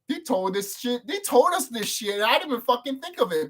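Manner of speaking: re-enacted disbelief, pitched high; amazed realisation. Re-enacts his realisation while watching.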